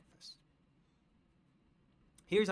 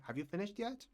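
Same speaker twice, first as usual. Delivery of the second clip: raised pitch, mildly impatient question; mock impatience; 7 semitones higher, 3 dB quieter, faster than usual. Teacher expecting you finished earlier, mildly impatient. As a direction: act like you expected it done already.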